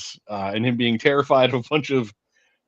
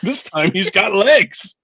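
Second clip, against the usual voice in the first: excited; 8 semitones higher, 7 dB louder, much slower than usual. Real, not acted. Amused punchline.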